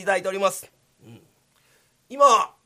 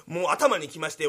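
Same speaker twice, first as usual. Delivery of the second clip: ordinary delivery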